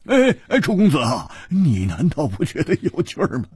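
high, loud, tickled exclamations; boisterous amused teasing; uproarious delight. A narrator's reading. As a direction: crow with glee as if applauding. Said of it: "胡铁花拍掌大笑".

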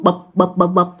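short, separated bumps; bụp as a repeated sound effect; imitated following thuds. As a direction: mimic a can hopping after someone. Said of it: "Thì nó cứ đi theo phía sau".

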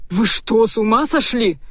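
startled, indignant question; a touch of alarmed disbelief; frightened babble. A narrator's reading. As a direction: blurt it out in fright. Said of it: "испуганно пролепетал он".

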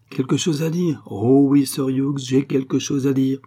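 drawn-up, emphatic retort; indignant retort. A narrator's reading. Sophie throws his words back.

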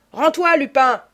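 high, loud yell; yelled order. A narrator's reading. Ganimard yells at cornered Lupin to give up, yelled order. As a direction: yell a command to surrender. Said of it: "hurla Ganimard".

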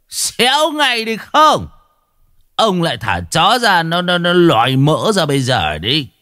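high, blustering shout at a beggar; a village chief yelling at a beggar by his gate, high and blustering; bullying shout. Acted. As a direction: bark him off like a petty village official. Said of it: "Lý Hương quát lên".

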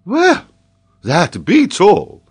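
high, loud, drawn-out outburst; flabbergasted professor bursting out; exasperated exclamation. Acted. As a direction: exclaim it in sheer disbelief. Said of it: "exclaimed Paganel".

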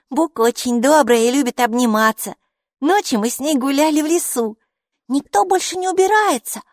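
high, loud letter dictation; eager child dictating. Acted. Майки dictates a reply.

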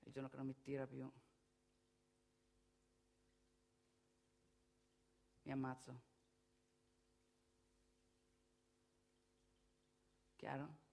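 low and hushed, with long pauses; grim, quiet resolve. Acted. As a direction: say it softly and finally, letting each phrase hang.

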